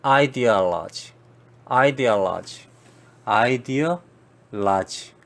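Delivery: ideology repeated slowly, EYE-dee-AH-luh-jee; ideology stretched out, English stress on AH; English pronunciation. Shown as a demonstration. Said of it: "이데올로기하고는 전혀 발음이 다르죠". (영어식 ideology 천천히 반복)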